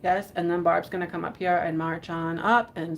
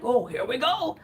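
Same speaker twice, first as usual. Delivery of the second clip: lightly voiced factory worker; a hint of the workers' way of talking; mock Cadbury worker; 4 semitones higher than usual. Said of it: "They talk like that".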